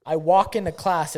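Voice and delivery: ordinary talk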